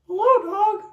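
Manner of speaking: very high squeaky duck. Acted. Ruben as Duck greeting Dog, squeaky high. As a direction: chirp it out. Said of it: "we have to use the voices".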